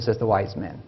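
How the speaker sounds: ordinary delivery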